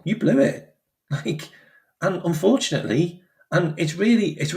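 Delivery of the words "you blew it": normal talking voice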